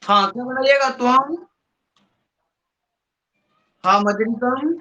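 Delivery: normal talking voice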